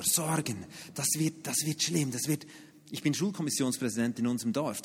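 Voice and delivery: ordinary delivery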